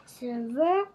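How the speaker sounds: ordinary delivery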